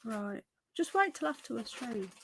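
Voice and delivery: half-whispered interruption to her partner; hushed aside. Real, not acted. Aside to partner who walked in.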